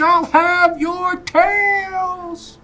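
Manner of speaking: put-on high, loud, slow cranky old man. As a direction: bellow at the kids from the porch. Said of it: "I'm gonna make the old man".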